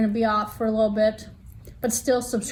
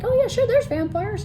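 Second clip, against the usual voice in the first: much higher-pitched, airy agreement; breezy acceptance; 12 semitones higher, 3 dB louder than usual. Acted. Reader happily accepting a horror book's world, breezy acceptance. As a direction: go high and easy, totally accepting. Acts out easy acceptance of fantasy.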